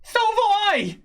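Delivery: very high indignant squeal; halfling merchant. Acted. Halfling merchant to robbed customer, high indignant retort. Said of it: "he says".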